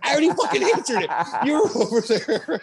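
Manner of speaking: high, fast, laughing mock outburst; exasperated coach snapping, half laughing; mock exasperation. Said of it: "He's like".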